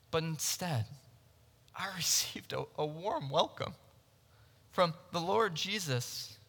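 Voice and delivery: quiet, grateful testimony in an acted role; tender, humbled wonder. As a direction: drop to a soft, hushed voice. Paul recalls undeserved welcome, after loud line.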